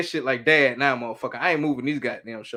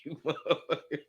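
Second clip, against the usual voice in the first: whispered jab; 9 dB quieter than usual. Real, not acted. Playful banter with friend.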